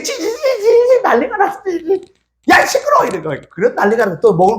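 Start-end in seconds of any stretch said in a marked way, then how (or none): 0.0-2.0 very high frantic squeaks; pinched, high mimicry of a panicking rat; squealing rat imitation. Imitates the trapped rat's frantic squealing. 2.5-3.0 very high-pitched shushing outburst; play-acted exasperated cry; mock telling-off. Acts out silencing the noisy rat.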